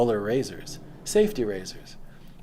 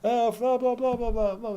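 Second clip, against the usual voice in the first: high, loud, mocking blah blah; mocking heckler; 13 semitones higher, 5 dB louder than usual. Mocking the shouting roughnecks in a high, loud sneer. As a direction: ham up a heckler jeering from the crowd. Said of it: "they're just shouting out shit".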